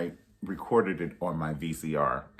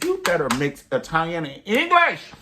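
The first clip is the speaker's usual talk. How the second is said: higher and louder hype; excited cheer; 9 semitones higher, 6 dB louder than usual. Retold or imitated. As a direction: cheer her on from the audience. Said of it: "I said".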